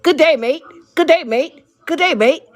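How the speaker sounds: imitating Australian coworkers saying hello; Australian greeting. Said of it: "all I heard all night long was".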